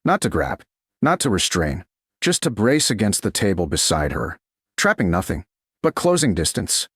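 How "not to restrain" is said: usual speaking voice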